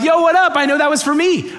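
high, loud, eager answer; excited reply. Retold or imitated. Speaker answering someone who called his name, excitedly. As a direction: pipe up high as if thrilled to be recognised.

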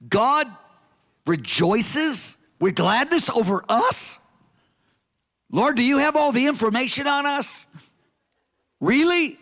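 acted disbelief. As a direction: question it in mock amazement. Acts out disbelief at God's delight.

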